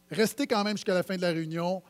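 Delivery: usual speaking voice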